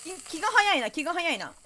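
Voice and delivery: surprised complaint. Real, not acted. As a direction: protest the hurry. Game customer too impatient. (驚いて文句を言う)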